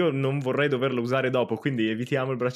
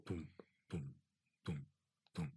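heartbeat thump; 9 semitones lower, 20 dB quieter than usual. Acted. Heartbeat sound effect.

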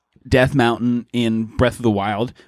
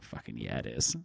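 hushed excitement; 14 semitones lower, 17 dB quieter than usual. Retold or imitated. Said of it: "And I was like".

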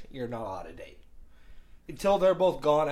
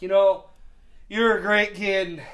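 put-on drunk dad; 6 semitones higher, 7 dB louder than usual. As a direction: imitate a tipsy father making an earnest speech. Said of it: "he's, he's drunk".

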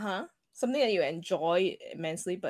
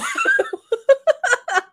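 loud burst of laughter; 9 dB louder than usual. Real, not acted. Laughing at porn guess.

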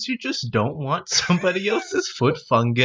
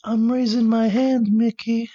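higher dopey cartoon voice; higher goofy cartoon flunky voice; cartoon sidekick voice; 7 semitones higher than usual. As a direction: play the dim board member raising a hand. Board member answering Mickey.

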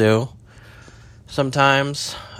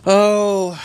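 a high, louder startled oh; sudden high-pitched exclamation; surprised oh; 9 semitones higher, 4 dB louder than usual. Real, not acted. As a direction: caught off guard.

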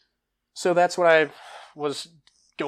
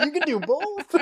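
laughing amazement; 7 dB louder than usual. Retold or imitated. Retelling his own amazement.

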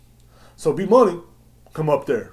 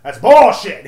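much louder, higher second blast of anger; retold yell; 8 semitones higher, 14 dB louder, slower than usual. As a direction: shout it as the customer loses his temper. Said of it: "He starts getting loud".

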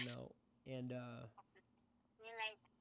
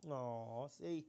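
usual speaking voice

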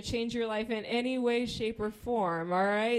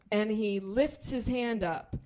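ordinary delivery

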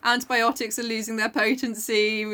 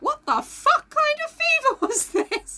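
high, drawn-out incredulous exclamation, close to laughing; amused disbelief, pitched way up; incredulous outburst; slower than usual. Acted. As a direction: stretch it out in baffled, high-pitched mock outrage. Mock outrage at book cliche.

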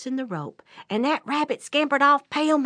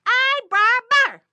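high, loud, drawn-out call; hollering; 13 semitones higher, 8 dB louder, slower than usual. Acted. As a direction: holler to someone far down the road. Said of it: "he hollers again".

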